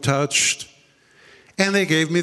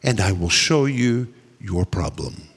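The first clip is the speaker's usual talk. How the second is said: voice of the Holy Spirit; 5 semitones lower than usual. Retold or imitated. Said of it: "the Holy Spirit said".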